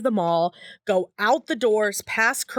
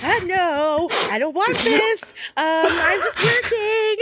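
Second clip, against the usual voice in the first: high pleading, slowing at the end; uncomfortable; 5 semitones higher, slower than usual. Retold or imitated. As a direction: plead to be left alone. Retorting to creepy man.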